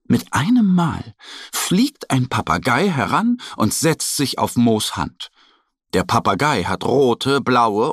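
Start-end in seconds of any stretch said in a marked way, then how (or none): none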